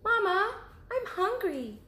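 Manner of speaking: high, small cub voice; little cub asking. Acted. Cub asking mother about sleeping outside, high little voice. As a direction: ask like a curious little bear cub. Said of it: "said Baby Bear".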